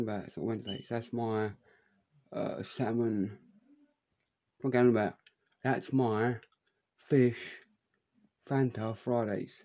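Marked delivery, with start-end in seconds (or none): none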